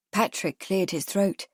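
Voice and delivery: ordinary delivery, nothing marked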